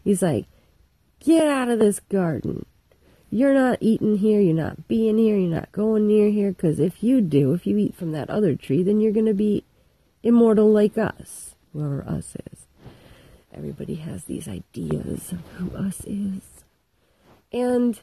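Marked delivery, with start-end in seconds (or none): 1.2-11.6 loud scolding voice; angry God. Retold or imitated. Angry God banishing them. 11.7-16.6 conspiratorial whisper; whispered aside. Real, not acted.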